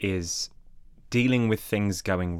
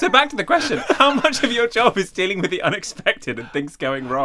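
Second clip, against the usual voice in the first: higher, laughing through it; 9 semitones higher than usual. Real, not acted. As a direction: still giggling. Host recovering from laughter.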